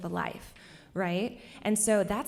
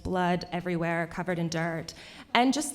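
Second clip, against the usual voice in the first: ordinary talk, nothing marked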